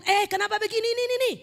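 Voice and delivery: high-pitched acted telling-off; lightly scolding question; mild rebuke. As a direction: pull someone up briefly, without real anger.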